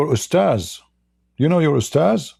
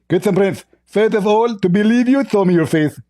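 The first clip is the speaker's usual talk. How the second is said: high-pitched, lisping mock debater; Zakir Naik; 7 semitones higher than usual. Host mimicking his debate opponent, high lisping impression. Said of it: "you are Zakir Naik".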